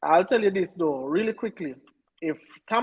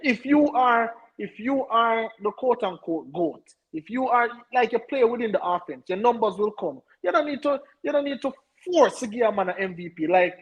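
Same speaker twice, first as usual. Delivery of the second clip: higher-pitched, insistent arguing; heated objection; 6 semitones higher than usual. Real, not acted. Co-host objects to forcing the MVP, heated and insistent. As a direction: push back hard, pitch up.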